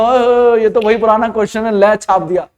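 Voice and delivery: mock a student pouncing on a familiar question; overeager student. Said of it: "तो आप ये मत बोलो".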